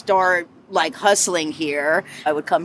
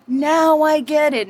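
retold epiphany; 9 semitones higher, 3 dB louder, slower than usual.

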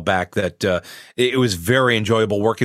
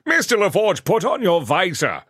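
bad Patrick Stewart impression; 9 semitones higher than usual. Shown as a demonstration. Voice actor demos his Kunkka voice for the interviewer. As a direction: ham up a commanding British sea admiral. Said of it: "my bad Patrick Stewart impression".

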